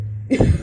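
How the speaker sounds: short breathy laugh bursting out; quick loud unvoiced laugh; burst of laughter. Real, not acted. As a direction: laugh at your own joke. Laughs at own remark.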